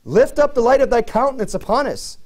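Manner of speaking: high-pitched cry to the Lord; acted cry for God's attention. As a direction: call out in appeal, pitch held high. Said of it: "They're even crying out to the Lord".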